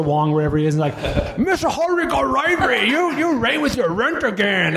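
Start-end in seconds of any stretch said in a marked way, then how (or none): 1.4-4.8 Mickey Rooney's fake movie accent. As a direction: lampoon the bad caricature accent from the old film.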